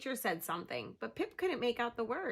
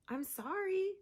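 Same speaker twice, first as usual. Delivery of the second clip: gentle whale voice; 9 semitones higher than usual. Acted. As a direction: say it kindly as a big whale.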